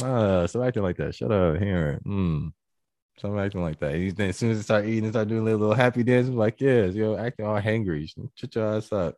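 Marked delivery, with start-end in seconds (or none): 0.0-3.9 low, unhurried mock scolding; playfully spicy. Feeding a hangry partner, a little spicy but not malicious. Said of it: "like, it's not malicious. Just a little, like". 6.6-9.1 low-key teasing scold; mock telling-off, no malice in it; playfully spicy. Teasing someone doing a happy food dance, playfully spicy. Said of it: "you can talk a little spicy".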